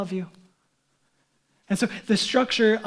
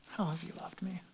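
a hushed, wounded question to God; whispered doubt; 4 semitones lower, 16 dB quieter than usual. Retold or imitated. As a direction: whisper it, barely there, as a private doubt. Voicing inner doubt toward God.

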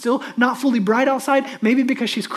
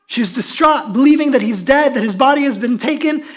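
raised, urgent preacher's voice; forceful preaching; 4 semitones higher, 7 dB louder than usual. Real, not acted. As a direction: press each point home loudly.

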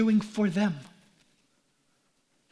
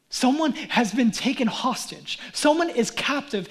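ordinary talk, nothing marked

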